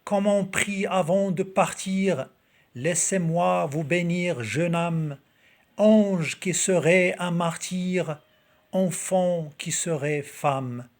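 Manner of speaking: slow, projected poetry reading; poem recitation. Acted. Reading Victor Hugo's poem to video viewers, slow declaimed recitation.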